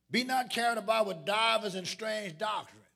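loud, measured preacher's reading; declaimed scripture. Real, not acted. Preacher reading the ninth verse to the congregation, declaimed scripture. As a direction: proclaim the verse with weight on each word.

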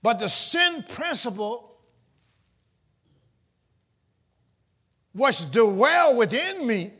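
slow, drawn-out reading; slow preached scripture. Real, not acted. Preacher reading the verse to the church, slowly. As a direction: stretch each word of the verse.

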